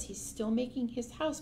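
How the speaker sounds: usual speaking voice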